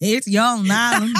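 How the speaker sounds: laughing imitation of bad Irish; mock Irish accent. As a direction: mimic a botched Irish greeting through laughter. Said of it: "supposed to be an Irish accent".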